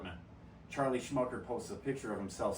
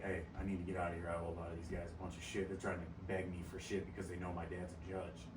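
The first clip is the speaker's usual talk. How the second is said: hushed plea; 5 semitones lower, 9 dB quieter, faster than usual. Retold or imitated. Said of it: "He's like".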